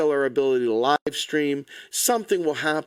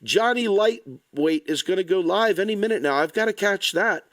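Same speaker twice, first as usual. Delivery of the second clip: higher-pitched mock excitement over a livestream; put-on giddy, high-pitched fan voice; mock eager fan; 4 semitones higher than usual. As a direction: gush like an easily distracted follower. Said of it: "people got it programmed in their phones".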